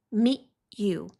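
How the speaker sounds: normal talking voice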